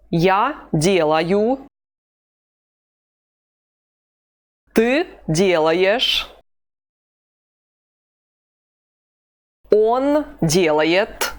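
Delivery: slow, deliberate model phrases with pauses; slow repeat-after-me drill. Shown as a demonstration. Teacher modelling slowly for students to repeat. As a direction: say it slowly for learners to copy. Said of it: "Повторяй за мной".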